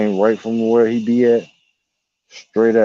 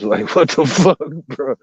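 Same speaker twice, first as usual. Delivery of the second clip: laughing what-the-fuck, pitched up; 6 semitones higher than usual. Real, not acted. Speaker laughing about the too-close owl. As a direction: laugh it off, higher.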